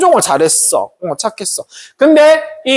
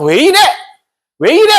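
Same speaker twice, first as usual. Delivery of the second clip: rising, higher angry yell at the donkey; annoyed rider; 8 semitones higher, 3 dB louder than usual. Acted. Balaam yelling at his balking donkey, higher and angry. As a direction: snap at a stubborn animal. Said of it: "발람이 처음에 막".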